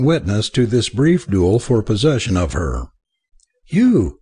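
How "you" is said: a higher, startled "You!"; startled cry. A narrator's reading. Dewar sees her rescuer in the room, startled cry. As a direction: gasp it out in shock. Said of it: "she cried".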